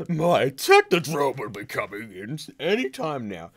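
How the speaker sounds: loud, high, camp cartoon villain; over-the-top campy villain. Fan mocking the movie's Krang, over-the-top campy voice. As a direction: ham it up, campy and theatrical. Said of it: "over-the-top, campy guy".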